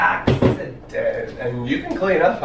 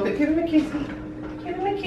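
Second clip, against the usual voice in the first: put-on baby talk to pets; 9 semitones higher, 5 dB quieter than usual. Talking to the cats.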